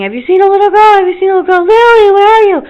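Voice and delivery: high, loud searching screams; retold panicked shrieking; frantic screaming. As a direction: scream to everyone for the lost girl. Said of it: "and screaming".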